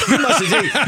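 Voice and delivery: laughing through the words; amused laugh while talking. Real, not acted. Laughs at partner's joke.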